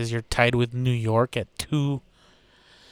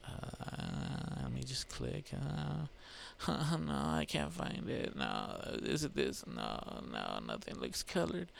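very soft, half-to-yourself search talk; muttering to self; 12 dB quieter than usual. Real, not acted. Podcaster clicking around the stats page for a region, muttering quietly. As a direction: think aloud quietly while hunting for something.

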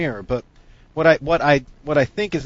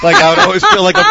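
excited; 10 dB louder, faster than usual. Real, not acted. Telling of a ghost fear.

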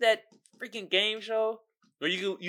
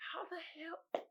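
a breathless whisper of disbelief; stunned whisper; 11 semitones higher, 21 dB quieter than usual. Real, not acted. Stunned whisper at the absurd answer choices. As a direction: mouth it in disbelief, barely voiced.

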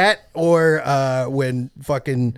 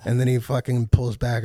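normal talking voice

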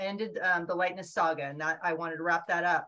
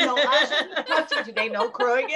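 louder mock scolding through laughter; laughing scold; 5 semitones higher, 7 dB louder than usual. Author jokingly scolding her unruly characters, laughing and louder.

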